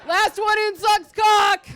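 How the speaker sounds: slow, high, very loud war cry; hammed-up Braveheart shout; battle yell. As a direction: bellow it charging into flames. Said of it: "runs in yelling shit like".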